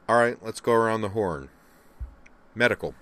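crisp captain calling the roll; businesslike. A narrator's reading.

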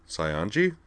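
"Saionji" with a questioning rise; inquiring. A narrator's reading. Wakaba waking up in an unfamiliar bed, inquiring "Saionji?" with a rise. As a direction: lift the end of "Saionji" into a question. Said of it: "she inquired".

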